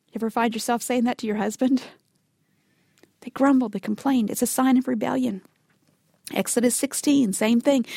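normal talking voice

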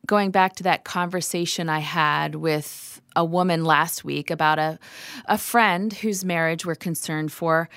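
usual speaking voice

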